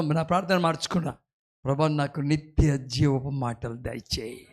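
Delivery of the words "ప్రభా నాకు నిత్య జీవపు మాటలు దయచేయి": low, slow, soft pleading prayer; hushed prayer. Retold or imitated. Preacher reenacting his prayer, low slow and hushed. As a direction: plead quietly with God, drawn out.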